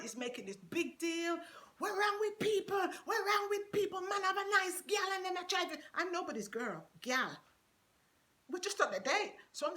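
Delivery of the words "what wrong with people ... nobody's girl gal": high shouted Jamaican patois complaint; Jamaican shouting rant. Retold or imitated. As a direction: shout it like an angry Jamaican DJ, pitched up. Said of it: "he's continued to rant".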